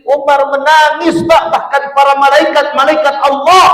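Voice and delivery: loud, very high climactic preaching; impassioned preaching. Acted. Preacher telling jamaah how the Prophet wept at Eid, loud and high. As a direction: build the fervour as each name weeps.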